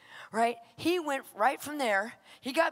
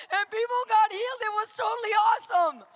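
high, loud, exultant shout; excited climax; 9 semitones higher, 7 dB louder than usual. Real, not acted. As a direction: proclaim it in triumph. Climax of her testimony.

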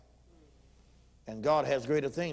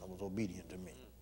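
ordinary talk

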